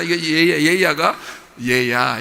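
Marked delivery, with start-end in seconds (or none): 1.6-2.2 gentle call. Shown as a demonstration. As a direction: call 얘야 kindly like a loving father. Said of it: "이렇게 좋게 보이니까". (얘야를 낮고 부드럽게 다정하게)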